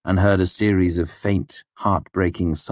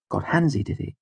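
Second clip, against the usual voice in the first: matter-of-fact; 6 semitones higher than usual. A narrator's reading. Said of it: "Denner said matter-of-factly".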